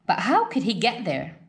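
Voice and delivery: ordinary delivery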